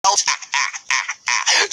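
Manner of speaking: a big booming laugh; hearty laugh. A narrator's reading. Gobber laughing at his joke, hearty laugh. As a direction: chuckle at your own joke.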